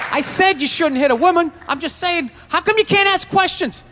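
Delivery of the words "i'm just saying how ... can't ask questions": ordinary talk, nothing marked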